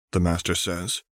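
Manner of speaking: ordinary delivery, nothing marked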